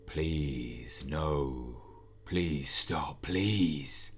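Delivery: low, distant pleading voice; ghostly plea. Acted. Shadowy figure voice at night.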